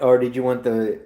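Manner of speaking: ordinary talk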